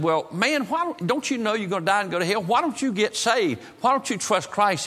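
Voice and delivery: high, loud, urgent challenge; exasperated pleading. Retold or imitated. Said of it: "I was about half mad at him".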